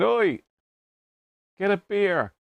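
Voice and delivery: lightly voiced bossy penguin; mock penguin shout. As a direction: voice the cheeky toy penguin demanding beer. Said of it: "Pengui's shouting at me".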